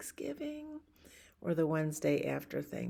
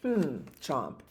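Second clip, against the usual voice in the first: mock chomping. Pigeon eating cookie.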